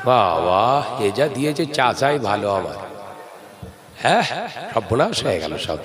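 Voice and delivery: usual speaking voice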